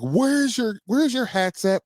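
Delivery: high-pitched retold scolding; exasperated parent calling out; irate. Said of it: "But I'm, like, irate".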